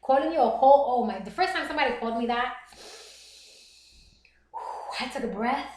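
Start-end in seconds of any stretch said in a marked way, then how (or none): none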